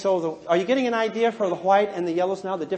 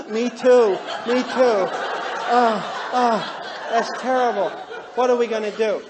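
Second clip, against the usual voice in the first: commiserate in exaggerated guilty distress; mock distraught. Two married blues sharing their guilt, mock distraught.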